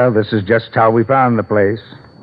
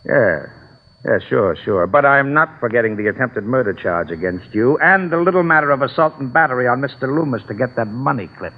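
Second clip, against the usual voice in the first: ordinary talk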